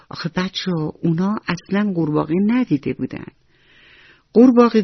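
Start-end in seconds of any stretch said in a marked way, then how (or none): none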